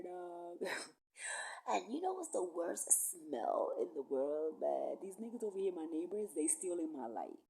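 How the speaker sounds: ordinary talk, nothing marked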